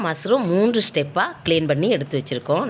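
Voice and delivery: usual speaking voice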